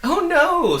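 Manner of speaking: high, loud, gushing rush of repeated words; fast excited little man. Acted. Weatherman greeting a traveller, high loud and gushing. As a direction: bubble over with welcome, words tumbling.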